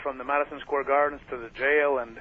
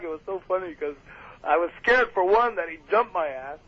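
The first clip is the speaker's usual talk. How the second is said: ordinary delivery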